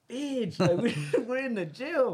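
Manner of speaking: high, incredulous retort breaking into laughter; mock exasperation. Retold alarmed retort to cousin in jail.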